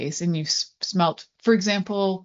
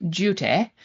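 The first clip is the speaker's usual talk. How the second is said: English accent; 2 semitones higher than usual. Shown as a demonstration. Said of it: "I need to say it with an English accent".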